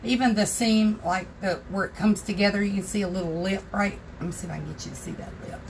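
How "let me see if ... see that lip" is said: muttered aside. Real, not acted. Aside while showing the cup.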